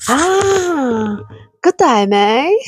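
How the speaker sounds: a light Australian accent on good day, mate; a cheery, lightly done Australian good day; Aussie greeting. Shown as a demonstration. Streamer teaching viewers an Aussie greeting in a light Australian accent. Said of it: "I can teach you Aussie".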